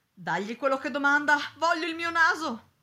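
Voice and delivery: loud, high insistent outburst; desperate order. A narrator's reading. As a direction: demand it back whatever the price. Desperate master orders his maid.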